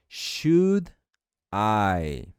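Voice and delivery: "Should" and "I" said slowly with a gap between; drawn-out teaching pace on "Should ... I"; word by word. Shown as a demonstration. Teacher gives words separately first.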